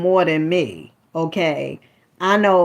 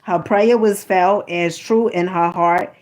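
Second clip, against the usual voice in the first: normal talking voice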